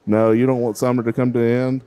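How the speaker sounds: ordinary talk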